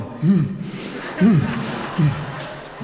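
high hummed growl like a dog; dog sound with a high hum. Retold or imitated. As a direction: hum back like the hound. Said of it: "Dog's going".

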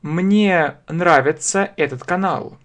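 usual speaking voice

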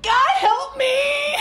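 high, slow, loud plea for help; slow, high, loud desperate mock plea; desperate cry. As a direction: wail help me up at God. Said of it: "you're like".

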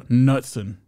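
Nutson said as "NUT-sun"; silent K. Shown as a demonstration. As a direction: start Nutson straight on the N, no K. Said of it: "I think the K is silent".

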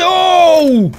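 ordinary talk, nothing marked

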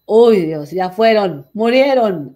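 hammed-up cry of despair over the class; theatrical lament, loud; mock despair.

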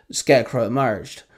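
ordinary delivery

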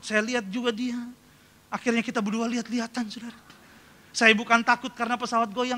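ordinary delivery

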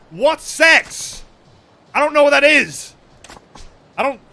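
put-on loud, high-pitched distressed girl; mock panic. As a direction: wail it in hammed-up alarm. Voicing panicked game girl.

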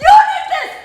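high-pitched real outburst of hurt and anger; anguished accusation. Real, not acted. Daughter accusing her father of betrayal, anguished high cry.

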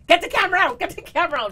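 loud, high urgent call; rushed excited yelling; excited shout. Retold or imitated. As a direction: yell it to grab the cameras. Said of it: "Jerome says".

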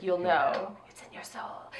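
softly, fading into a whisper; hushed whisper. Retold or imitated. Retelling his line to her co-host, dropping to a whisper. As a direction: hush it like a secret. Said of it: "He's like".

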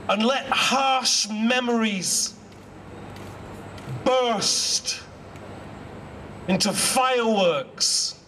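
slow, measured reading with long pauses between phrases; poem recitation. Acted. Poet reciting his poem to assembled students and staff, slow and measured. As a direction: let each image land, unhurried and deliberate.

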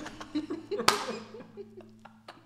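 high, breathy burst of laughter; real wheezy, high-pitched laugh; laughing hard. Real, not acted. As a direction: crack up helplessly. Friends cracking up during reaction.

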